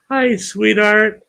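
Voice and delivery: high, slow, sing-song hello; sweet greeting. Real, not acted. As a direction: coo it sweetly to a friend. Greeting a viewer in chat.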